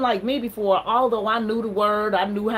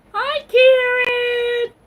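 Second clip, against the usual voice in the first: very high, slow, drawn-out hello; sing-song greeting; 8 dB louder, much slower than usual. Real, not acted. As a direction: stretch the greeting way up high like calling to a friend. Greeting viewers on live stream.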